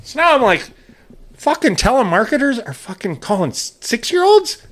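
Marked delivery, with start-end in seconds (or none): 1.3-4.6 retold outrage, pitch climbing very high; pissed off. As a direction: climb into high-pitched disbelief as you re-enact it. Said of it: "So now I'm pissed".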